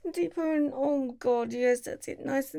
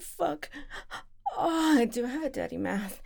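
ordinary delivery

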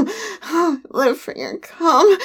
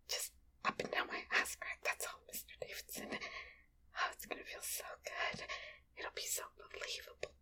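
urgent hushed whisper, barely voiced; secret whisper; 21 dB quieter, faster than usual. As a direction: whisper so nobody else hears. Whispered asides to partner.

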